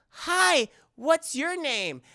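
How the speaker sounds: put-on high, slow kid greeting; little boy voice. Andy greets the doll in a high, slow child voice.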